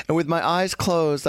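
usual speaking voice

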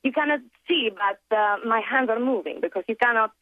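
English with a lilting Italian accent; playful demo of an Italian accent. Shown as a demonstration. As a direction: speak like an Italian speaking English. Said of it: "a bit of an Italian accent right now".

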